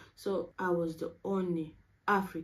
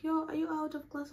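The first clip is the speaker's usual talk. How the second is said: higher, breezy imitation of the friend; casual friend's call; 9 semitones higher than usual. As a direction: toss it off like a casual check-in. Friend calls asking if free.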